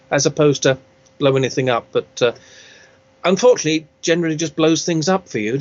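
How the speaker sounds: usual speaking voice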